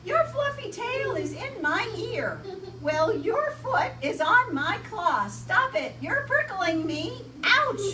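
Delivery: high, loud squabbling; squabbling animals. Acted. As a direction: squeal complaints up high.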